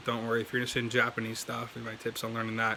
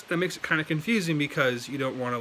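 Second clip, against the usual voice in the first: ordinary talk, nothing marked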